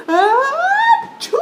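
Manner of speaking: high, loud drawn-out sneeze; a sneeze that takes over the voice; big sneeze. Acted. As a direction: build an ah then blast the choo. Piggie sneezes in story.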